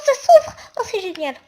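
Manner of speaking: high, loud burst of joy with a laugh; real excited yelp; excited delight. Real, not acted. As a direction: shout it out, thrilled it worked. Door suddenly opens in game.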